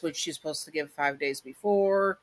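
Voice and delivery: ordinary talk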